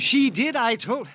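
high, loud cry of denial; cornered man shouting his denial; desperate protest. Acted. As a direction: cry out that it's true. Suspect cornered, protesting loudly.

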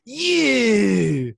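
long, high, loud YOU; over-the-top podcast opener howl; exaggerated intro shout. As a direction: belt out a drawn-out, falling YOU. Mimicking his mocked trademark intro shout.

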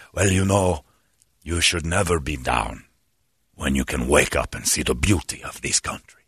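low, solemn smooth attendant; beautiful speaking voice. Retold or imitated. Attendant cheering up a guy who's down, beautifully spoken. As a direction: imitate a deep-voiced philosopher consoling someone. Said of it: "He's got a beautiful speaking voice".

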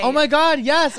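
high, loud delighted response; real excited squeal; excited cheering. Real, not acted. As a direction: cheer loudly for your friend's news. Cheering the friend's birthday news.

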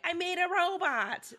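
put-on a boy proudly announcing his invention; lightly voiced small son; imitating a little kid. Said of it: "he'll come in and be like".

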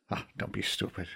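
dismissive scoff. Acted. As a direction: wave the idea away. Fergal dismisses Conor.

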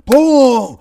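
sudden loud, high boom; booming thunderclap. Retold or imitated. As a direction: shout the thunder crash.

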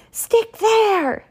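high, loud outburst; angry yell. A narrator's reading. Baker flinging dough at the ceiling, angry yell. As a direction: yell it in a fit of temper. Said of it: "he yelled at the dough".

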